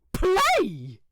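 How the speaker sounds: snapping angrily. A narrator's reading. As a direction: bite the word off in offence. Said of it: "snaps Aziraphale".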